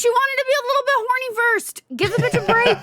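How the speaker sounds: high mocking sarcastic voice; sarcastic defense. Mock defending the character.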